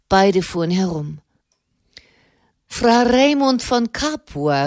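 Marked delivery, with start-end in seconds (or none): 2.7-4.7 calling out. A narrator's reading. Soderini calls out to Fra Raimund, relieved and raised. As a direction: call Fra Raimund across the square. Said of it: "rief Soderini".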